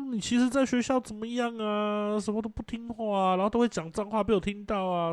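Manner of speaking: put-on teacher reporting a misbehaving kid to the parent; complaining teacher. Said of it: "不然你要听老师讲说".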